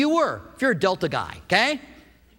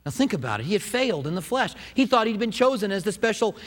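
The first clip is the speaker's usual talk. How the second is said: ordinary talk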